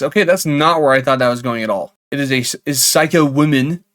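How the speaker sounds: ordinary delivery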